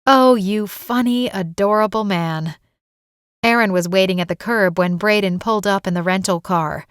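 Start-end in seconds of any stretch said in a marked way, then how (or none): none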